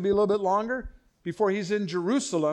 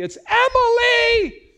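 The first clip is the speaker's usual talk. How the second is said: high, slow, loud correction; indignant yell; 11 dB louder, much slower than usual. Retold or imitated. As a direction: insist on the name loudly. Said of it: "She goes".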